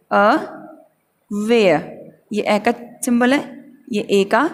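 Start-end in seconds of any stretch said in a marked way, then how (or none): 0.1-1.9 aware very slowly as "uh... WAY-uh"; slow r-less demo of aware; broken in two. Shown as a demonstration. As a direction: sound out aware with a long gap after "uh". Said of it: "यहाँ पे ये word stress है".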